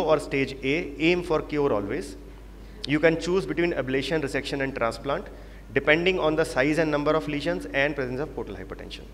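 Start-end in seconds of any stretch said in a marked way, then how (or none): none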